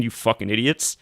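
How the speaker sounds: ordinary talk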